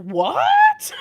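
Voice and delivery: shrill, loud startled cry; shocked what. Retold or imitated. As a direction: jump in surprise on the word. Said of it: "And it's like".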